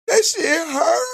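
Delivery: exaggerated fake crying wail; mock wailing. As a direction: howl it like an overdone crybaby. Mocking a crying woman's video.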